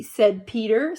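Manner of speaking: ordinary delivery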